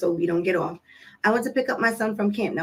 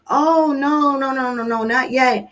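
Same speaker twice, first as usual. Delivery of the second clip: quick, higher retold correction; hasty denial; 4 semitones higher, 3 dB louder than usual. Mom tells her son dad is not back, quick and flustered. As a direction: rush out a string of no's. Mom hurriedly answers son's question.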